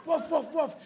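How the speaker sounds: high, confident barks; very sure dog. Acted. Dog certain of an intruder, high decisive barking. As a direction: woof with total certainty. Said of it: "They're very sure dogs".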